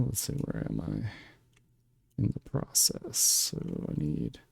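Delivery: slow whispered self-talk; whispering to himself. Real, not acted. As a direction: mutter it under your breath, slowly. Muttering to himself while working.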